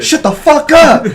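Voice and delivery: high, slow, very loud; drawn-out exasperated shout; retold furious yell. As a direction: bellow it at a noisy moviegoer. Imitates friend snapping at girl.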